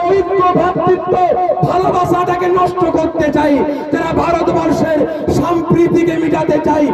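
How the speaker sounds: chanting crowd-rousing sermon shout; fiery preaching. Acted. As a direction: rouse the crowd from the stage.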